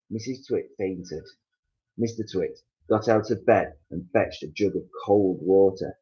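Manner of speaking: plain reading voice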